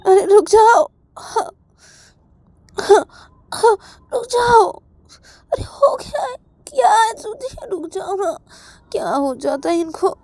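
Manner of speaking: high, loud, tearful pleading; begging a friend to come to her senses, voice breaking; pleading and crying. Acted. A panicked girl pleads with her friend, crying.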